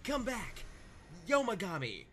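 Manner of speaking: calling out. Acted. Calling after departing god.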